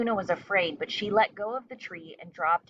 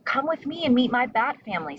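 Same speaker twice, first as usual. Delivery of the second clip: higher, louder eager call; eager invitation; 6 semitones higher, 4 dB louder, faster than usual. A narrator's reading. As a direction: invite her friends excitedly. Stella inviting birds eagerly.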